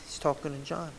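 usual speaking voice

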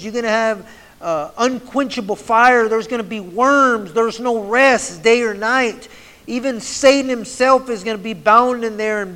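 fiery hellfire sermon, pressing hard; preaching at full force. Acted. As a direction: thunder it out to the congregation. Preaches warnings about hell.

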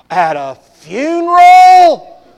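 high, loud, drawn-out scandalized cry; horrified churchgoers crying out, slow and high; shocked congregation. Retold or imitated. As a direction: gasp it out in mock horror. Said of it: "they're like".

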